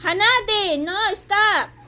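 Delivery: high, loud command to a dog; scolding shout. Real, not acted. Owner ordering Hanadi to stop biting, loud scolding shout. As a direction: shout to halt the misbehaviour.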